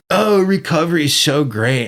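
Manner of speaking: mocking chirpy recovery fan, pitch up; sarcastic cheerful imitation; mock excited. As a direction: parody the eager newcomer. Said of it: "people that are, like, excited to be there".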